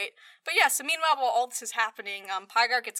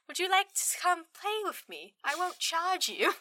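high mock seductive offer; high voice, slowing to a teasing end; a hint of a flirty villain, higher; 7 semitones higher, 3 dB quieter than usual. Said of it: "she's like".

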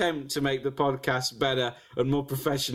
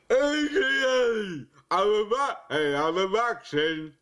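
drawn-out, slurred action-star hollering; Sylvester Stallone; 3 semitones higher, much slower than usual. Acted. Player retrying an action-star impression, drawn-out mock tough guy. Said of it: "Sly Stallone".

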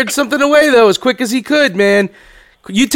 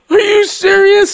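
much higher and slow, pleading; high disbelieving plea; 9 semitones higher, slower than usual. Retold or imitated. Friend begging Miguel not to throw him, high panicked plea. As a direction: squeak out the panicked question.

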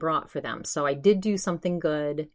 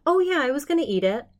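polite; 9 semitones higher, faster than usual. Retold or imitated. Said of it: "so I just said politely".

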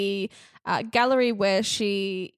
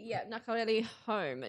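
usual speaking voice

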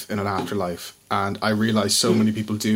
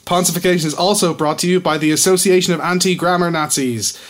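ad announcer voice; 4 semitones higher, 4 dB louder than usual. Pretend. Mock sponsor read.

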